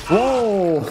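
sudden high startled cry; startled whoa. Real, not acted. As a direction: jolt up in pitch, caught off guard. Sudden enemy attack in game.

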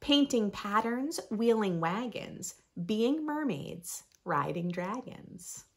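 lively, sing-song picture book reading; reading to children. Acted. Reading a rhyming book to young listeners, bright storytime voice. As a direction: read it like storytime for little ones.

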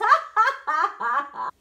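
very loud, high laugh; gloating guffaw. Acted. Horse races off sure of winning, gloating laugh. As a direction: roar with boastful laughter.

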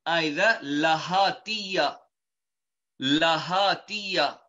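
Lahatiya said slowly, every syllable clear; term Lahatiya. Shown as a demonstration. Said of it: "are known as".

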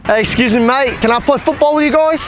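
asking politely in a put-on British accent, voice lifting; mock British accent, high and bright; fake British accent. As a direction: play the Brit who wants to join the football game. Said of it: "pretend I'm British".

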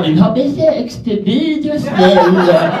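high, slow mocking girlfriend voice; a hammed-up exasperated woman; mock outraged ex. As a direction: play the scorned girlfriend, dragged out. Ex's outburst, acted.

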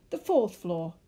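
loud repeat in disbelief; outraged, punchy shout; screamed. A narrator's reading. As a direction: shout it out in exasperation. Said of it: "the man screamed".

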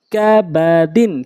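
drawn-out syllable by syllable reading; slow demo. Shown as a demonstration. Teacher showing learners the word first, slowly. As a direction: stretch each syllable for learners. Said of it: "প্রথমে আমি slow বলছি".

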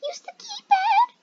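very high, crying squeal; panicked squeal. Real, not acted. Player urging keypad use under attack, panicked squeal.

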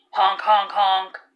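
honking horn. Acted. Car horn imitated.

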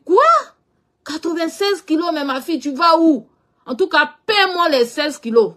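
imiter une mère effarée, voix plus haute; exclamation incrédule rejouée, haut perchée; stupeur maternelle. Retold or imitated. Mère choquée par le poids annoncé. (mère stupéfaite, ton aigu rapporté)

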